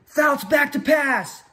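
high, drawn-out play-by-play call; announcer. Retold or imitated. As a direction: call the pass as it unfolds. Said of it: "I would turn into the announcer again".

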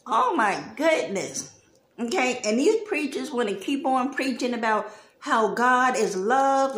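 ordinary talk, nothing marked

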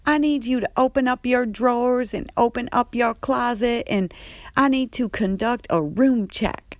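high, loud, sassy announcement; the sassy staff member ordering a room check; Andre's voice. Retold or imitated. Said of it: "So Andre goes".

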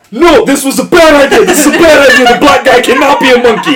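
high, loud mock panic; acted flustered shouting; panicked backtracking. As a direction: yell it like someone frantically calling it off. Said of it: "see the sweat and be like".

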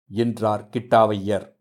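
ordinary delivery

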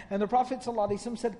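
ordinary delivery

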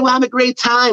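put-on high, chirpy party-girl voice; bubbly high-pitched imitation; girly friend voice. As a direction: play her up as cheerfully naive, pitched high. Friend Rachel voiced in story.

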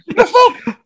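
very high, loud falsetto outburst; proper high pitch. Retold or imitated. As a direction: squeal it in comic disbelief. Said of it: "Go proper high pitch".

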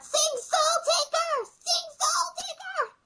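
very high, drawn-out mock scream; stretched squeal of a fan, at contained volume; imitated concert scream. Fan calling for a song at concert, imitated high scream. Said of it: "I was the one screaming out".

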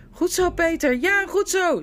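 loud, high cheering shout. A narrator's reading. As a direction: yell encouragement across the field. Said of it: "schreeuwde Edmund".

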